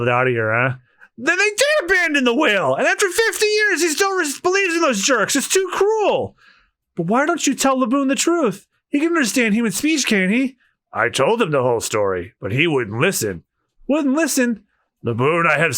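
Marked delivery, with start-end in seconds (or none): 1.2-10.6 put-on much higher, earnest pleading girl; high young woman voice. Same woman voice, outraged. 13.9-14.6 put-on much higher, earnest pleading girl; high young woman voice. Same woman voice, incredulous.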